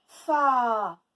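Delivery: FA loud with a long a; stretched syllable. Shown as a demonstration. Teacher letting pupils hear the A, stretched syllable. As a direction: stretch Fa into faaa. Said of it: "Où est-ce qu'on entend A?".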